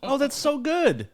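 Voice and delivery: high, gushing mock mom voice; doting mom. Mom praising kid's finger painting, high and doting. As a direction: coo praise as an indulgent mom. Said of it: "That's what moms do".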